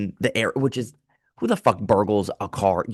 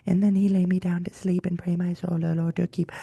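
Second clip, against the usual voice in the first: high, eerie lullaby voice; creepy lullaby; 8 semitones higher than usual. Pretend. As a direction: make the lullaby sound creepy. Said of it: "some lullabies that are creepy".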